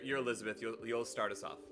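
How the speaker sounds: ordinary talk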